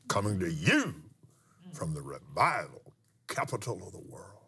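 measured radio announcer delivery, swelling on key words. Retold or imitated. Preacher retells the words heard over his plane's audio, imitating a radio voice. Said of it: "it came in that radio voice".

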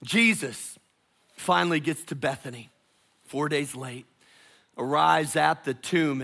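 usual speaking voice

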